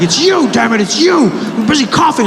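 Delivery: high-pitched mock hysteria; panicked accusation. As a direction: point the blame in a rising, squeaky panic. Passenger accuses him again, acted.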